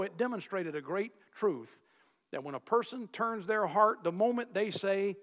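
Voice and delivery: ordinary delivery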